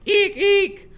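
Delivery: high squealing eeks; kids' mock screams. Little kids fleeing a monster, playful squeals. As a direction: squeal like kids pretending to be scared. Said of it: "they make these screaming noises".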